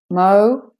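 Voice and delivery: "Mo" loud and full; stressed syllable. Shown as a demonstration. Teacher breaking the word up, stressed "Mo". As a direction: hit "Mo" as the strong syllable.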